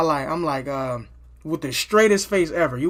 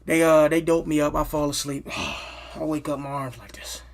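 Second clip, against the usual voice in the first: ordinary delivery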